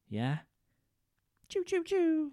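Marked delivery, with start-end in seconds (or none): 1.5-2.3 high mock laser-gun zaps. Describing the space battle finale, mock laser zaps. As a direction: fire off cartoon laser noises.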